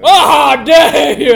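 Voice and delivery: loud huffy outburst; offended shout. Acted. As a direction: bellow in wounded pride. Sheriff offended at being called big.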